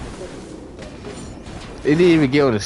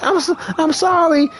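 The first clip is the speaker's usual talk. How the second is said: lightly voiced apologetic girlfriend; 9 semitones higher than usual.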